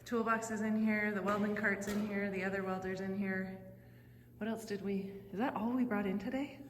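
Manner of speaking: ordinary talk, nothing marked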